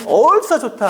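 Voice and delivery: God's glad cry of welcome; joyful exclamation. Retold or imitated. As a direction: exclaim it like a father overjoyed to see his child. God joyfully welcomes returning sinner.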